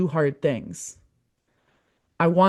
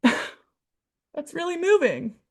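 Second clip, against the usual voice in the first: choked up, laughing; 10 semitones higher, much slower than usual. Real, not acted. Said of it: "I'm getting choked up again".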